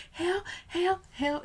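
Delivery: high, loud mock calls of help; mock cry for help. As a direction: squeal it like the meme guy going under. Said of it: "he's like".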